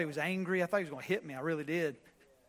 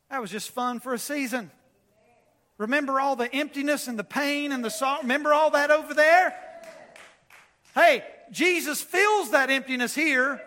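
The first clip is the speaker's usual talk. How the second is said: rising from firm to a full, higher shout; building preaching; 6 semitones higher, 6 dB louder than usual. Acted. Preacher urging the congregation not to go back, building to a shout. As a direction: drive it home louder and louder.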